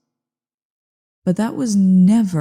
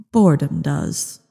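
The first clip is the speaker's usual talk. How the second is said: ordinary talk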